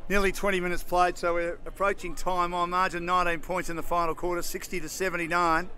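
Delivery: brisk football commentator calling the play; live play-by-play. Acted. Caller describing the match to radio listeners, brisk play-by-play. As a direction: call each move as it happens.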